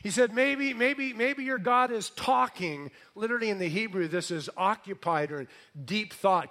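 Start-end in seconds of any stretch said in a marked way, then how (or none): 0.3-2.9 high-pitched taunting suggestion; acted mockery, pitched well up; mocking. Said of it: "Elijah starts to mock these guys".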